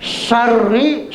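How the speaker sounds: "Syarri" with a strong, full sh; big shin. Shown as a demonstration. Teacher showing the letter shin, strong sh in "Syarri". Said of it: "shinnya shin besar".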